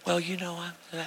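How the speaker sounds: tiny, very soft attempt to speak; imitating an unheard guest; barely audible. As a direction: start timidly and fade to nothing. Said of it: "no one heard her".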